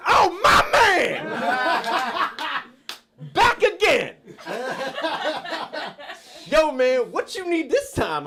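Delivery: very high, loud welcome-back shout; hammed-up over-caffeinated clerk; super-pumped clerk. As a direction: holler it high, thrilled to see a regular. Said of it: "He was super pumped".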